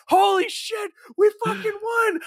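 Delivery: high, loud shouting in disbelief; whole team screaming in triumph; ecstatic victory yell. Retold or imitated. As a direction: yell it like you just won against all odds. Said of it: "And at the end, they're like".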